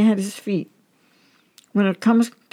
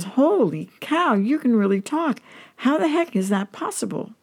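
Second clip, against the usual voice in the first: shocked; 7 semitones higher than usual. A narrator's reading. Guy hears the dog talk, acted shock. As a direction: act amazed, stretch the first words high. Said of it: "The guy is shocked and says".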